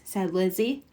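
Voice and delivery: ordinary delivery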